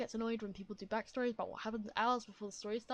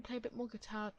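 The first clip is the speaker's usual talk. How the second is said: usual speaking voice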